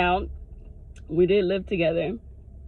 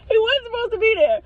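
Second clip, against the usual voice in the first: high-pitched insistent repeat; high, animated indignation; emphatic high pitch; 12 semitones higher, 4 dB louder than usual. Real, not acted. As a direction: push the whole line up high in protest.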